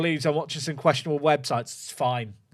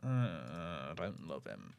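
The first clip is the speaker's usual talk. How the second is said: deep deadpan; 11 semitones lower, 14 dB quieter than usual. Pretend. Low, slow, deadpan verdict.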